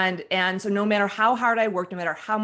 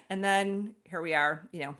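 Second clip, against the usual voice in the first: normal talking voice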